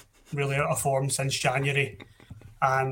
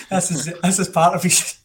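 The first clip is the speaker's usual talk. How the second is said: laughing; 4 semitones higher, 7 dB louder than usual. Real, not acted. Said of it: "I can't say it with a straight face".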